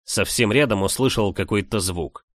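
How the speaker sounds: ordinary delivery, nothing marked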